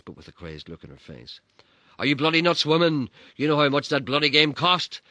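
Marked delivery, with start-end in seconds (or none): 2.0-5.0 loud, higher frantic cry; frantic dad. Retold or imitated. Dad frantically pleading with his wife, loud. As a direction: panic over the money. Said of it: "my dad frantically cried".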